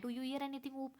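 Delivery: quiet ear whisper. Retold or imitated. Omega quietly asks Oop, hushed. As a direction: murmur it close to his ear. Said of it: "चुपचाप ... उसके कान में बोलता है".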